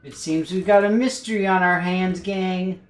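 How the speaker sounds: hammed-up cartoon mystery sleuth. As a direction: ham it up like a cartoon detective. Scooby-Doo style line to viewers.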